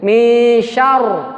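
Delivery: Mi syar with lips forward and heavy r; rounded syar. Shown as a demonstration. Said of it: "maka maju dulu bibirnya".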